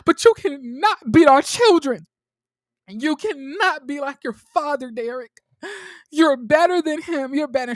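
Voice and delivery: high, loud acted plea; impassioned pleading. Woman begging Derek not to be like his father, impassioned pleading.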